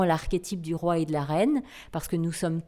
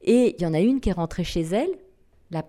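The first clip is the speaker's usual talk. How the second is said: normal talking voice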